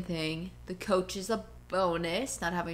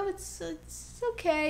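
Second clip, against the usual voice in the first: polite fake okay; 9 semitones higher, 4 dB quieter, slower than usual. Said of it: "she was like".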